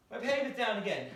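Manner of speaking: loud, high groaning dismay; not again; exasperated. Real, not acted. Teacher finds the paper fallen again, loud exasperation. Paper falls a third time.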